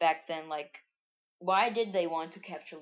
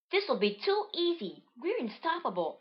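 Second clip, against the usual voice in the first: high, confident cartoon voice; high singsong bragging; Marina's voice; 10 semitones higher than usual. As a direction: boast in a pitched-up character voice. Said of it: "That was Marina".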